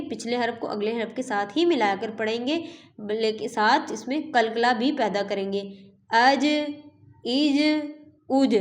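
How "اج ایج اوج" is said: the ج in اج, ایج, اوج released with a sharp bounce; qalqala echo. Shown as a demonstration. Teacher showing students the qalqala echo. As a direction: bounce the ج in اج clearly at the end. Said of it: "تو اس میں قلقلہ پیدا کریں گے".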